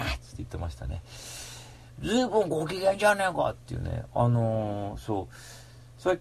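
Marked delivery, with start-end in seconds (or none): 2.0-3.5 higher, gruff mock-buddy voice, falling at the end; imitating a pal ribbing you; うーちゃん impression. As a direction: put on a friend's teasing tone. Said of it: "うーちゃんの真似するとやっぱりウケますね".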